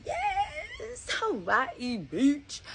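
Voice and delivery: squealing high, slow and dreamy; a thrilled daydream squeal; giddy excitement. Retold or imitated. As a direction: gush over the beach dream. Dreaming of the Hawaii trip.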